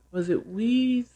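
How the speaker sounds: normal talking voice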